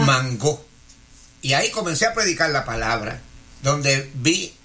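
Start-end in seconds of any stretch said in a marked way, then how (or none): 0.0-0.6 "mango" stressed on its last syllable, man-GÓ; acento en la O. Shown as a demonstration. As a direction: demonstrate "mango" with the force landing on the final O. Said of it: "Le ponemos el acento en la O". ("mango" pronunciado mangó, con fuerza en la O final)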